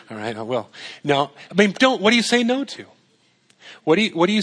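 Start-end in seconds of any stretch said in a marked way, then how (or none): none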